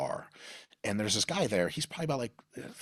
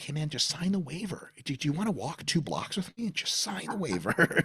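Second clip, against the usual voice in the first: mock friendly challenge; 10 semitones higher than usual. Mock friendly invite to fight.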